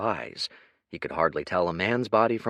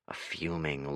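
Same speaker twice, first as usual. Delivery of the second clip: ordinary delivery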